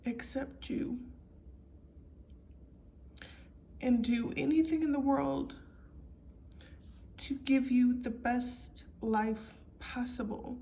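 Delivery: fighting back tears while she retells her parents' promise; voice breaking. Real, not acted. Recalling her adoptive parents' love, voice breaking with tears. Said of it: "I'm getting emotional".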